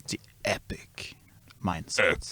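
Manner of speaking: hushed, dramatic trailer whisper; movie trailer voice. Acted. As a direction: breathe it like an epic trailer. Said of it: "a movie trailer voiceover".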